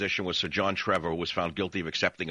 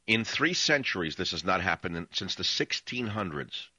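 ordinary talk, nothing marked

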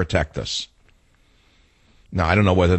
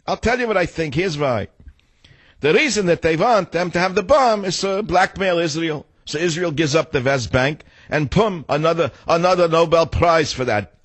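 put-on old New York Yiddish accent, high and fast; 7 semitones higher than usual. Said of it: "He does it in a mock New York Yiddish voice".

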